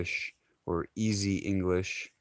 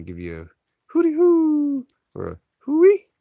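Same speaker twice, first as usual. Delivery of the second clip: hooty hoo and hui called out much higher and louder; calling hui; 10 dB louder than usual. Shown as a demonstration. Explaining slang to viewers, a high loud hui call. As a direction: holler hui like a call across the yard. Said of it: "uh, hui".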